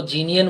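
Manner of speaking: जीनियन said as "jee-ni-yan"; wrong pronunciation. Shown as a demonstration. Teacher showing the class another common mistake: जीनियन said jee-ni-yan. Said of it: "कई लोग इसको ... बोल देते हैं".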